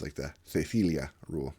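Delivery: Castilian lisp. Shown as a demonstration. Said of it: "in European Spanish".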